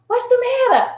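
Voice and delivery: high-pitched urgent question; alarmed mom. Retold or imitated. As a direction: burst in worried about your child. Acts out rushing to crying child.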